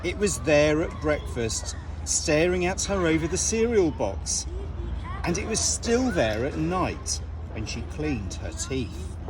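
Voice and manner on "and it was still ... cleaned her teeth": ordinary delivery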